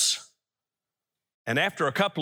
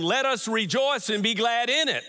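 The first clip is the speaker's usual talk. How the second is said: lifted, higher emphasis on "let us rejoice and be glad in it"; stress on REJOICE; 5 semitones higher than usual. Shown as a demonstration. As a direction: drive home "rejoice and be glad". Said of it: "I put the emphasis on".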